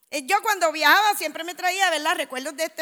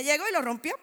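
ordinary delivery

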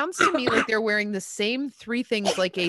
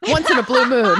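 louder, higher punchline; punchline shout; 5 semitones higher, 10 dB louder than usual. Acted. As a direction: belt out the punchline. Punchline about cocaine.

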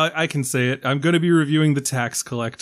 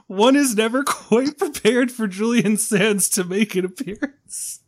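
grand mock title; 7 semitones higher than usual. Shown as a demonstration. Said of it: "The subtitle is".

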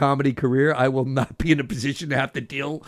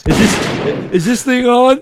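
higher, louder mic-check gag; old borscht belt comic; 9 semitones higher, 6 dB louder, slower than usual. Pretend. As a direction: tap the mic like a tired club comic.

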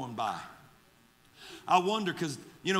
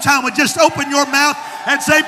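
high, loud revival preaching; full-force preaching; 7 semitones higher, 14 dB louder than usual. Real, not acted. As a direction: shout it to the congregation in driving phrases.

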